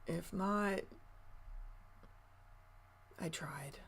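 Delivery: barely voiced shrug; resigned whisper. Real, not acted. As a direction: let it go in a whisper. Shrugging off a possible loss.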